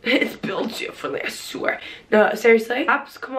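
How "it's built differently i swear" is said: giggly unvoiced whisper; laughing whisper. Real, not acted. Whispered joke about body.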